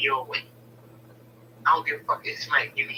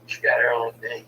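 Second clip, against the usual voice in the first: usual speaking voice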